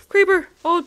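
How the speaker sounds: loud, high gasp and cry of alarm; alarmed shout. Real, not acted. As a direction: yell a warning in a panic. Creeper appears.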